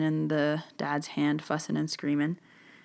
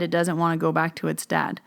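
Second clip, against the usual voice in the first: usual speaking voice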